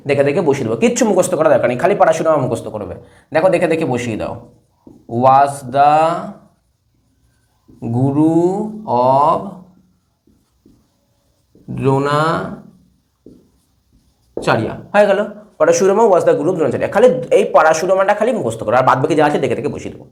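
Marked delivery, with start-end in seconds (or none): 5.2-14.7 very slow, long gaps between words; drawn-out dictation. Shown as a demonstration. Teacher writing the answer for students, drawn-out dictation. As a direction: dictate word by word at writing speed.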